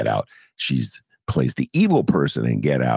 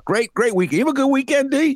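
much higher, hammed-up host voice; old bit voice. Host doing his old bit to D, high hammy voice. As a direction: crank it up high like a cheesy host. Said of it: "do my old bit".

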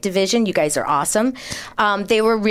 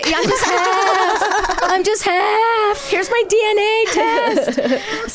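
very high, drawn-out wail in jest; mock plea; 12 semitones higher, much slower than usual. Joking plea to police about being half, mock wail. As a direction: plead slowly in a comically high voice.

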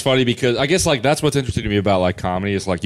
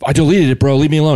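louder, higher retold protest; exasperated, raised-voice brush-off; defensive arguing; 6 semitones higher, 5 dB louder, faster than usual. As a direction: snap back to be left alone. Acts out retold quarrel.

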